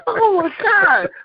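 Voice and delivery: high, drawn-out wail through laughter; slow squeal, overcome with laughing; uncontrollable laughter. Real, not acted. Host cracking up at Joe's answer, uncontrollable laughter. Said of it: "I can't stop laughing".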